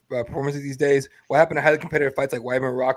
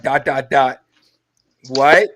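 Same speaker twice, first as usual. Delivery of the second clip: louder incredulous what; mock disbelief; 3 semitones lower, 6 dB louder, much slower than usual. Texting back to buddy, mock disbelief. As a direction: act out baffled reply. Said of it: "I just text back".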